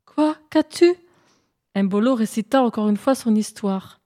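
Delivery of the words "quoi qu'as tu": high, light figure voice; moon's voice. Acted. Moon asks the hare what is wrong, high-pitched. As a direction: answer in a raised, questioning voice.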